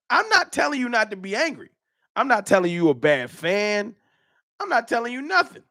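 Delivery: mock-innocent high taunt. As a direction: needle him in a high, singsong voice. Mocking the angry fan.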